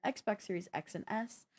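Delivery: normal talking voice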